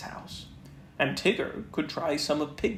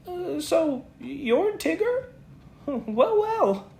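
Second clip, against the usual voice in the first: careless; 14 semitones higher, 3 dB louder, slower than usual. Acted. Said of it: "in a careless sort of voice".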